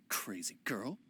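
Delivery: muttered insult. Acted. Rebuffed merchant about the accusing girl, low muttering. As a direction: mutter it down under your breath.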